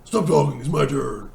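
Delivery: loud mock gravel growl of a caped hero; gravel Batman voice. Batman interrupts Superman, loud mock gravel growl. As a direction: bark it like a hammy Batman cutting Superman off. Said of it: "Gotta do the gravel, gravel voice?".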